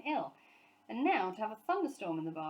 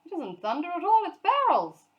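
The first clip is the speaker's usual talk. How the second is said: much louder, higher alarmed cry; alarmed shout; 10 semitones higher, 12 dB louder than usual. A narrator's reading. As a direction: call out the danger. Sir Huntley realising the danger.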